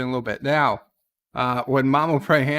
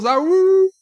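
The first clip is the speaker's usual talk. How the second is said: high wolf-like awoo; playful howl; 7 dB louder than usual. Pretend. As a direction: howl like a happy dog. Dog praised, playful howl.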